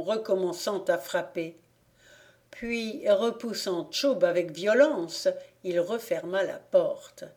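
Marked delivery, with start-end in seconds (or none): none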